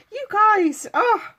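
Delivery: high, loud teary laughing cry; tearful laugh. Real, not acted. To viewers, crying and laughing at once. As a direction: break into tears and laugh at it. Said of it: "you're starting me off".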